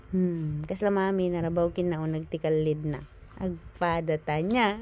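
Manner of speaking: very low mock voice for the baby; voicing the baby. Baby complaining he is sleepy, very low mock voice. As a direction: grumble as the baby.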